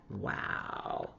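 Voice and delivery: whispered, hushed amazement; breathy wow. Acted. Reader amazed by the toy box, whispered wow. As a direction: marvel at the toys under your breath. Reacting to toys.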